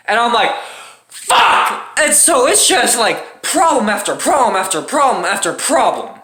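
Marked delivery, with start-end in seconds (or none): 1.1-2.0 high, breathy yell; strained yell. Retold or imitated. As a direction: blurt it out in despair. Car out of gas.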